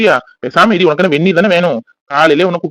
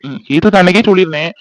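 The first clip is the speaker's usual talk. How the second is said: normal talking voice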